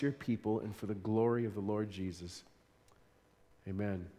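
low, soft, hushed prayer. Acted. Pastor praying softly over the congregation before the sermon. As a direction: pray quietly to God before preaching.

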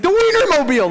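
very high, loud proud announcement; husband revealing what he saw, very high and loud; triumphant reveal. Retold or imitated. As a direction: crow the answer. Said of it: "And he's like".

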